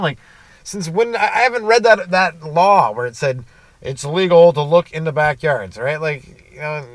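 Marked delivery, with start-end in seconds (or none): 0.6-5.8 high-pitched, incredulous challenge; indignant protest. Retold or imitated. Driver questioning the cop about a law, indignant. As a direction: argue back, pitched up and louder.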